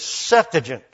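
"Septuagint" with the stress on SEP; stress on first syllable. Shown as a demonstration. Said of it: "It is the first one".

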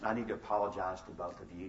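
low, soft acted apology; solemn apology. Retold solemn apology to his kids.

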